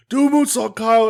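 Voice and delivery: high yelled heckle from the stands; mock crowd yell. Fan in the crowd yelling up to the wrestler, high. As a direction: call it up to the ring like a fan. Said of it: "somebody yells up".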